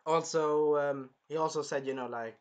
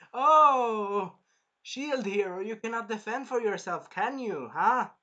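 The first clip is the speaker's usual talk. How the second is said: higher, sneering tease; mocking taunt; 5 semitones higher, 5 dB louder, slower than usual. The prince taunting the shield hero, sneering. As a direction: jab at his weakness.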